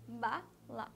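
"bala" in two separate beats, ba then la; sounded-out syllables. Shown as a demonstration. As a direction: say "bala" with a pause between its syllables. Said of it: "Escutem o som da palavra". ("bala" pausadamente, sílaba por sílaba)

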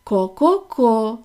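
slow ko-ko-ko clucking; a hen's slow clucks. Acted. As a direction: cluck it out slowly like a hen. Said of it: "закуткудакали кури".

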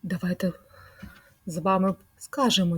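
usual speaking voice

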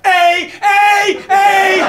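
high, loud hammed-up shouts; mock shouting. Second officer reacting to hearing Canada, loud high mock shouts. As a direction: shout it as an exaggerated Canadian interjection.

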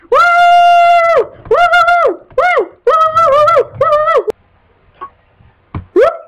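high, loud whoops of joy; real joyful high whooping; excited cheering. Real, not acted. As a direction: cheer like your team just won. Excited cheering for Jurassic map.

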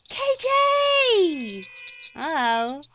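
high, loud call of a viewer's name; excited greeting. Real, not acted. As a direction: shout the name out in delight.

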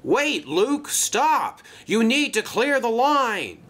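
high-pitched urgent call; urgent warning. Acted. Merrick stopping Luke from entering danger, urgently calling. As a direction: call out to halt someone at once.

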